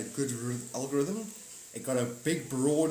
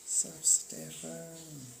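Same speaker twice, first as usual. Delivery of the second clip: lazy chat greeting; 4 semitones higher, 6 dB quieter, much slower than usual. Retold or imitated. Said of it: "you guys are just like".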